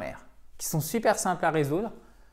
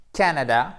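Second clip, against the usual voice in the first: Canada hit hard on its first syllable; a loud, clear first syllable in Canada; stress on first syllable; 7 dB louder than usual. Shown as a demonstration. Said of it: "accent tonique sur la première syllabe".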